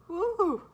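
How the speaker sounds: high, loud "woo"; emotional whoop. Real, not acted. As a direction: let out a sudden high whoop. Overcome by the lyrics she reads.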